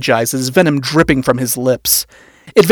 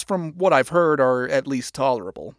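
ordinary delivery, nothing marked

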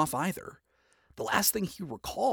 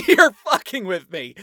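louder, higher, laughing in disbelief; 6 semitones higher, 11 dB louder than usual. A narrator's reading. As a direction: laugh it out, can't believe it. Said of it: "burst into laughter and doubled over".

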